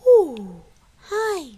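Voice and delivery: usual speaking voice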